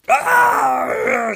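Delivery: high, loud pained scream; Necron's scream. Acted. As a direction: cry out as if your heart is ripped out. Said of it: "screams Necron".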